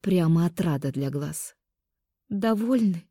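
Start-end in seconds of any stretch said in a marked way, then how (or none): 2.3-3.0 babbling through tears. A narrator's reading. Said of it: "пролепетала сквозь слёзы".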